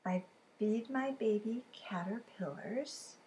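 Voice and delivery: slow whispered riddle line; whispering the hornet riddle to kids. Acted. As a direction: hush it, slowly.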